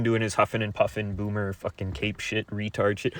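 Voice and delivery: ordinary talk, nothing marked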